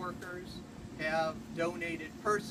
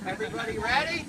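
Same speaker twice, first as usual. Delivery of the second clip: calling out; 5 semitones higher, 7 dB louder, faster than usual. Real, not acted. Calling out to the whole group.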